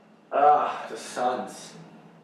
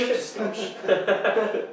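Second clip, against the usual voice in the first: mock echo; 7 semitones higher, much slower than usual. Mock repeat, slow, laughing.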